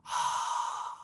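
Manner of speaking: breathy open-mouthed exhale; resonant ha breath. Shown as a demonstration. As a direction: sigh out like waves on the ocean. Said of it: "That's that sound of".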